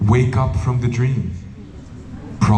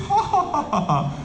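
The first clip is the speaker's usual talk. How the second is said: high, thin put-on ha ha ha; hammed-up laugh to brush off a shout; fake laugh; 5 dB quieter than usual. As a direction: force a nervous laugh. Forced laugh at the shouting guru.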